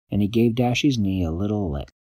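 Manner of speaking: plain reading voice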